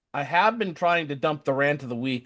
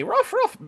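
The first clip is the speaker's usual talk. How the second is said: yappy mock dog bark, much higher. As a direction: bark like a cute little puppy. Imitates dog barking.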